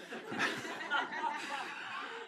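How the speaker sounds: breathy high laugh after the joke. Real, not acted. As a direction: let a laugh break out. Laughs at own parody.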